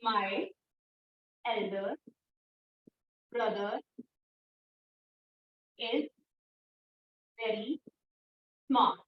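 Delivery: low, soft, with long pauses between words; isolated words for students writing along; word-by-word dictation. Shown as a demonstration. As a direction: dictate slowly, one word at a time. Teacher dictates example word by word.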